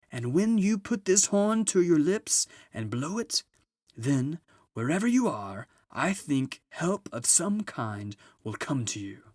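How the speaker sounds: high, booming jolly Father Christmas; a grand, merry old gift-giver arriving at last; Father Christmas announces his arrival to the children. Acted.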